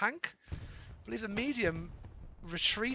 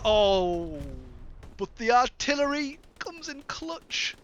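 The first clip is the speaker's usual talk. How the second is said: sudden loud, high play-by-play burst; excited outburst; 5 semitones higher, 6 dB louder, slower than usual. Real, not acted. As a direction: shout it as the big moment lands. Artillery saves the supplies.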